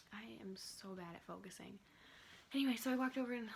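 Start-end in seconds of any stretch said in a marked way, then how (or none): 0.1-1.8 breathy whispered aside; whispering. Real, not acted. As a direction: whisper it, a bit flustered. Flustered aside about focus.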